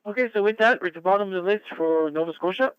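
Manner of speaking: high, loud radio call-out; net control announcer. Real, not acted. Net control calls stations.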